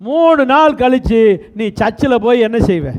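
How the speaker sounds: ordinary talk, nothing marked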